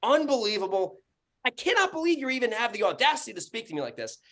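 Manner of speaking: high-pitched incredulous outrage; freaking out. Retold or imitated. Landlord retells freaking out at tenant, indignant high voice. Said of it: "I'm freaking out".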